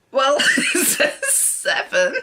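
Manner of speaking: slow, giggling protest; can barely get it out for laughing; laughing through the words. Retold or imitated. To the shop girl, insisting through helpless laughter. Retelling her reply, laughing.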